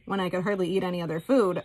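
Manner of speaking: ordinary talk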